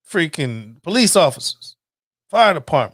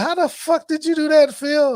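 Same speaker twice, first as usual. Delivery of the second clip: amazed relatives; 11 semitones higher than usual. Retold or imitated. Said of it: "they're like".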